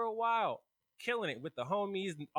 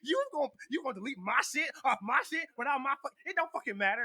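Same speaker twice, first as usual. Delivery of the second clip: high, stammering outraged rant; furious imitation; 10 semitones higher, faster than usual. Whitley rants at Cass over deleted game, mock furious. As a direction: imitate her blowing up, voice pitched up.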